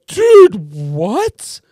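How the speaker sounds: high, loud, incredulous "dude"; stunned disbelief. Retold or imitated. To the cop friend who outed him, high and loud disbelief. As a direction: caught off guard, cry out in disbelief. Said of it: "I go".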